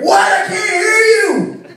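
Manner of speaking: put-on high, loud little-boy yell; screaming kid. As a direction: yell back from the other room. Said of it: "he starts screaming".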